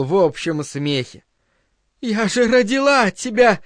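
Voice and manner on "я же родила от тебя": high, desperate cry; shouting over noise. A narrator's reading. Linda topping the crowd's laughter, crying out. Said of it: "прокричала она, покрывая шум".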